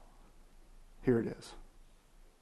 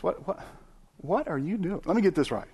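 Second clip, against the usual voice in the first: higher mock disbelief at the kids; incredulous what; 6 semitones higher, 3 dB quieter than usual. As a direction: play baffled, catching them in the act. Mock scolding little kids.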